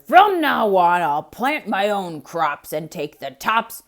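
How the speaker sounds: loud furious holler; hollering bear. Acted. Bear hollering at Hare after being tricked, loud. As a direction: bellow his name in rage. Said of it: "he hollered".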